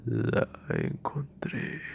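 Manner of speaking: slow, drawn-out ghostly whisper; eerie voice. Acted. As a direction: creep it out very slowly and softly. Eerie voice from outside the room.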